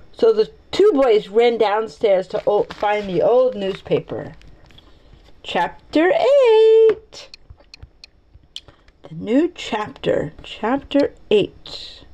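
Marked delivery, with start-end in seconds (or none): 5.4-7.3 drawn-out, high, rising heading; grand announcement. Pretend. Reader announces the next chapter, high drawn-out fanfare. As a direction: herald it playfully, stretching the last word.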